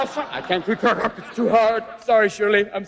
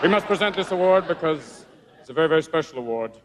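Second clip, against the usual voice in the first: deep solemn voice; 9 semitones lower than usual. Acted.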